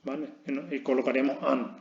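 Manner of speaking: ordinary talk, nothing marked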